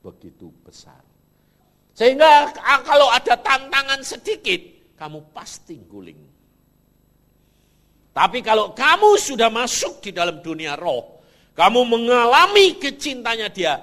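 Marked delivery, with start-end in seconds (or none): 2.0-4.9 loud, high, fervent preaching cry; preaching shout. Real, not acted. Preacher warning the congregation they will fall, shouting. 8.1-13.8 loud, high, fervent preaching cry; preaching shout. Real, not acted. Preacher warning the congregation they will fall, shouting.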